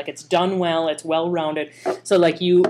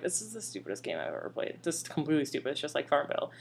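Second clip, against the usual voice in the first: low disgusted aside; 3 semitones lower, 13 dB quieter than usual. Retold or imitated. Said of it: "I look over at Mike and I'm like".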